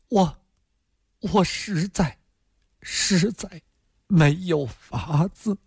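high, hurried plea that breaks up and fades; helpless, fading voice. A narrator's reading. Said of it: "他声音 越说越低".

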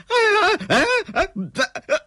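very high, half-crazed yelps; frightened out of wits. Acted. Villain threatened with a knife, high half-mad terrified yelps. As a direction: yelp helplessly in sheer terror. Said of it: "吓得是半癫半疯的".

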